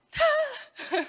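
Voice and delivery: a squeaky high "tá" after the scare; shaky high reply. Retold or imitated. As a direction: answer in a tiny shaken voice. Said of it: "eu falei".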